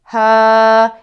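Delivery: loud, controlled ha driven from the diaphragm; powerful ha. Shown as a demonstration. Voice teacher showing a powerful diaphragm-driven ha. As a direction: punch each ha out from the belly. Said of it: "If this tone isn't controlled and powerful".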